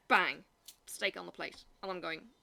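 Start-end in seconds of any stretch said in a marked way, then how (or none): 0.1-0.4 loud, punchy "Bang!"; sharp bang. Retold or imitated. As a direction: slap the word down like the steak hitting the plate. Steak slapped on the plate.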